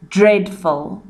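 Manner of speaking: usual speaking voice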